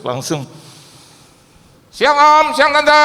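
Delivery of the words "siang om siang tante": loud, high cheerful hello; loud greeting. Retold or imitated. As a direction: call out hello across the house. (menyapa keras)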